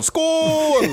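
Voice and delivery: high, drawn-out mock toast yell; long, high-pitched holler; imitated yell. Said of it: "then Sean yells out".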